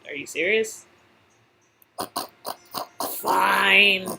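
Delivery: ordinary talk, nothing marked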